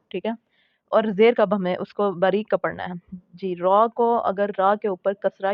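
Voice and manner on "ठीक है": ordinary talk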